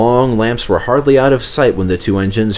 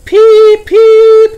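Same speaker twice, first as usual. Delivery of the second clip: high, much louder whistle calls; whistled reply; 12 dB louder than usual. Acted. Stepney whistling back to the crowd, high and loud. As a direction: whistle back loudly in greeting. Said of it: "replied Stepney".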